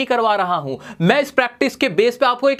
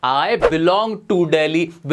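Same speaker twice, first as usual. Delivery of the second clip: stretched very slowly, each word apart; slow model phrase; 4 semitones lower, much slower than usual. Shown as a demonstration. Teacher modelling a new phrase for students, very slow. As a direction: draw the phrase out for the class. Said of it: "आप बोलो".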